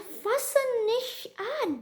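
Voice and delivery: high, slow, scolding German; angry German mother. Retold or imitated. Mimicking the mother scolding her in German, high and slow. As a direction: drag out the scolding words like the mother did.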